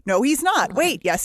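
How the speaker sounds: normal talking voice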